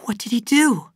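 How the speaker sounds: high, excited question; urgent, high-pitched curiosity. A narrator's reading. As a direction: break in eagerly, pitch jumping up. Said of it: "Abra broke in excitedly".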